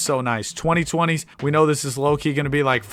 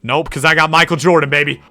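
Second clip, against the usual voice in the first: loud, higher crowing; excited boast; 4 semitones higher, 7 dB louder than usual. Real, not acted. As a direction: crow over a great pick. Bragging over a great pick.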